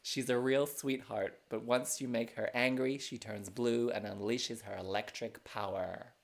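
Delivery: hammed-up American accent. Shown as a demonstration. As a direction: keep the American host voice going. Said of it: "Here's my American accent".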